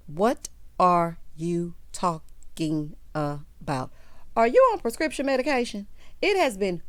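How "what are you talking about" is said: stretched out, every word dragged in disbelief; slow, drawn-out incredulous question. Real, not acted. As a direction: draw out What are you talking about? slowly, baffled.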